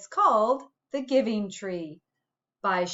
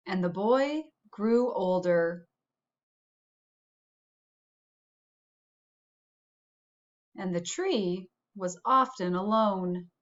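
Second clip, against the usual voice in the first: put-on storytelling for kids. A narrator's reading. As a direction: read it like bedtime story time for the class. Reads a picture book aloud.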